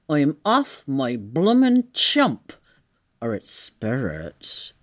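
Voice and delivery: slow tramp voice, sinking low; bewildered tramp. Acted. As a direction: conclude you've lost your wits. Tramp fears he is mad.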